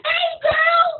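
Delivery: high, squealed greeting; excited. Shown as a demonstration. Coach showing how to greet a friend, very excited. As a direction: greet your girlfriend with a burst of energy. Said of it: "be excited, be like".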